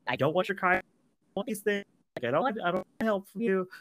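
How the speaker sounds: high, quick snooty refusal; high mocking voice. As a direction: sneer it out fast and high. Acted character refusing help.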